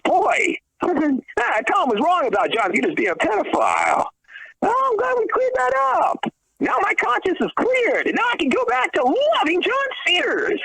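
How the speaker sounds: high, hammed-up gushing fan voice; mock relieved fan. Mocking viewers thanked for clearing it up, gushing high. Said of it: "you can come back and say".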